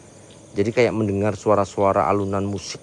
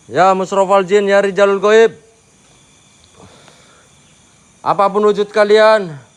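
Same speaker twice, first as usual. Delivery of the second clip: slow, raised calling to the spirits; summoning invocation; 8 semitones higher, 9 dB louder than usual. Acted. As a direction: invoke the jinn out loud. Calls out to the spirits.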